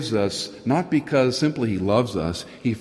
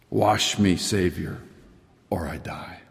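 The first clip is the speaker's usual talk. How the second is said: fading prayer; 3 semitones lower, slower than usual. Real, not acted. Hymn line, fading to whisper.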